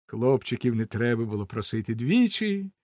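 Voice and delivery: usual speaking voice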